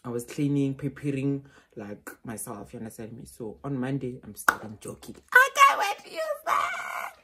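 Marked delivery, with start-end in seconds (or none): 5.3-7.2 very high, loud shout; excited shout. Real, not acted. Vlogger shouting to viewers about new headphones. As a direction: burst out with excitement.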